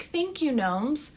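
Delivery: lightly voiced toddler thanking gnomes; mother gently imitating her toddler. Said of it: "and is like".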